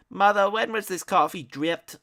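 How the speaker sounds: high, loud, pompous demand. A narrator's reading. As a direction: snap the question like a spoiled son. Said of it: "Ignatius demanded".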